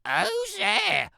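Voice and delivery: a wolf's put-on grandma voice; wolf faking granny. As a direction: pretend to be granny in bed. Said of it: "I called out".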